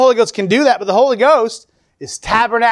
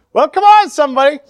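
rousing call; 5 semitones higher, 4 dB louder than usual. Real, not acted. Preacher rousing the congregation.

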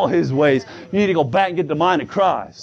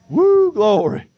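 whoop of praise; 7 semitones higher, much slower than usual. Real, not acted. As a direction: burst out in church jubilation. Exclaims praise while preaching.

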